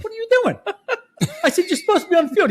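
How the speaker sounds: high laughing exasperation; laughing disbelief. Retold or imitated.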